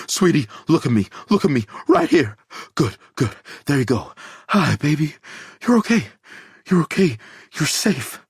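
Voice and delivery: high, tearful soothing with crying; sobbing father soothing his daughter; sobbing comfort. A narrator's reading. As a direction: comfort her through sobs. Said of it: "He begins to sob".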